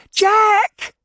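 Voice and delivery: very high, loud frightened cry; cried out. Acted. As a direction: scream his name in fear for him. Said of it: "she cried".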